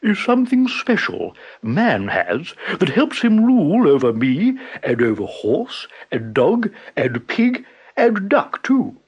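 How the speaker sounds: slow, high and pompous; grandly. A narrator's reading. Buffalo showing off to Tiger, slow and pompous. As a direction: lecture it like a proud buffalo. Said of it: "explained Buffalo grandly".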